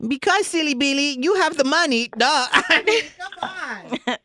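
high, bubbly put-on voice; party planner voice. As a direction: play an overexcited party planner. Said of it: "pretend that I'm a party planner".

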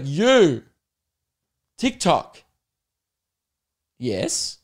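halting blurted words, answer whispered; caveman fan again. Retold or imitated. Comedian retells fan's clumsy TikTok question in mock caveman voice. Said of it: "She's like".

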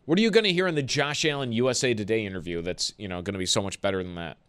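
ordinary talk

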